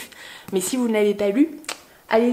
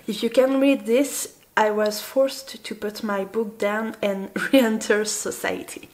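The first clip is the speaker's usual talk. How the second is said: English read aloud with a French accent; self-mocked French accent in English; slower than usual. Real, not acted. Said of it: "Avec mon superbe accent anglais".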